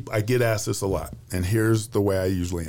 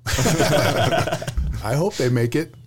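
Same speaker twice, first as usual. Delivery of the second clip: laughing; 5 semitones higher, 3 dB louder, much slower than usual. Real, not acted. Laughing at playoff question.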